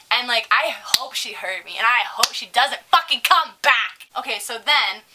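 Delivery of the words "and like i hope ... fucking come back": usual speaking voice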